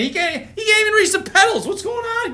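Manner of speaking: high, fast amazement; a duck that can't reach the pedals, said in high amazement; amazed. Real, not acted. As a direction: squeal it in disbelief.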